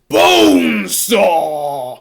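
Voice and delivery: long, loud drawn-out mock roar; wrestler bellow. Introducing Bonesaw at the wrestling tournament, bellowed.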